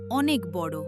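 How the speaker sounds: usual speaking voice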